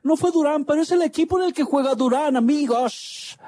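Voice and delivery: high-pitched radio goal call; breakneck goal commentary. Acted.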